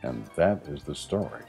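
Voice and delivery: plain reading voice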